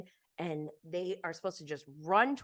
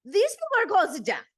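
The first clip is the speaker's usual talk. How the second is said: mock horror; 12 semitones higher, 7 dB louder than usual. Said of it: "she's like".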